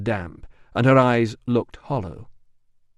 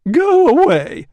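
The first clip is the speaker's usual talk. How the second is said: very high, loud shriek; outraged bather shrieking; 11 dB louder, slower than usual. Acted. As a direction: shriek him out of the room. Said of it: "shrieked a woman".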